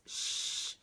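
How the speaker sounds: sh as a long unvoiced hiss; phonogram sound sh. Shown as a demonstration. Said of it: "So when I first teach this".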